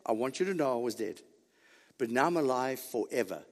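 soft, low, confiding quote; gentle reassurance. Acted. Preacher voices Christ telling John he lives, gentle reassurance. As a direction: confide it softly, pitch dropped.

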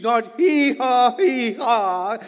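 drawn-out braying hee haw; donkey bray. Shown as a demonstration. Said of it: "He speaks".

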